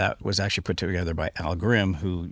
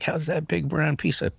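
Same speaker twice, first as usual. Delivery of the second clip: higher, lightly mocking jab; teasing buddy; 7 semitones higher than usual. Imitating friend Jody teasing him about the truck, higher and mocking.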